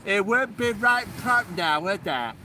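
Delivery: loud hammed-up West Country yokel; broad Somerset "right prop now"; mock Somerset accent. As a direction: ham up the Somerset catchphrase. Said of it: "is that what you sound like".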